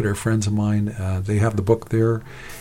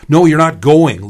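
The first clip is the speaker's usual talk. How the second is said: loud, raised-pitch refusal; shouting; 6 semitones higher, 9 dB louder than usual. A narrator's reading. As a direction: shout down a pleading younger brother. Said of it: "Leon was shouting".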